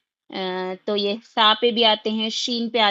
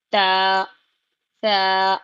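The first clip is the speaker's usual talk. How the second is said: ordinary talk, nothing marked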